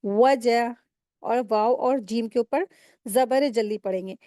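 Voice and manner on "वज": "वज" with lips fully rounded into a w; rounded waw. Shown as a demonstration. Teacher correcting the lazy waw, rounded-lip articulation. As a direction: round the lips firmly to start "वज". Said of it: "होठों को गोल करेंगे तो ये वाव अदा होगी".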